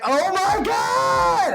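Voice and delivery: high, slow, drawn-out squeal of shock; starstruck gasp. Retold or imitated. Fiance hearing Ren on the phone, high drawn-out starstruck squeal. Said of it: "And she was like".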